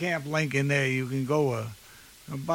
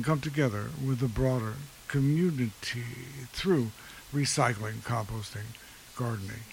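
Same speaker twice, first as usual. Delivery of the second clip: NPR voice; 5 semitones lower than usual. Acted. Host reading a recycling center's mission, in an NPR voice. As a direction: read it like a dignified public radio host, with deliberate pauses. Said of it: "I'll put on my N P R voice and say".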